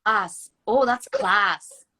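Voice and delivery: put-on light Irish accent on "class", drawn out slowly on the repeat; imitating her Irish fiance saying "that's class"; Irish fiance imitation. Telling the host how her Irish fiance says "class", in a light Irish accent. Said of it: "my fiance says things are class".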